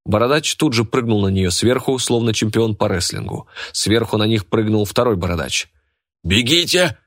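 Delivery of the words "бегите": high, raised-voice warning; urgent shout. A narrator's reading. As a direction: call out for them to flee. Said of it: "крикнул он". (тревожный крик)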